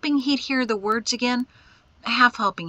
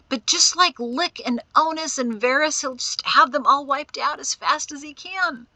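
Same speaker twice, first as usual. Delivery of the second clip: high, loud, anguished outburst; distraught, pitched-up rant; tearful frustration; 7 semitones higher, 4 dB louder than usual. A narrator's reading. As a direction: rage at yourself in despair. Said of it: "Tears of frustration and anger".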